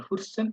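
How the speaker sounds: ফুসসেন with rounded lips, the n swallowed; round-mouthed German. Retold or imitated. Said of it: "মুখটারে গোল করে বলে".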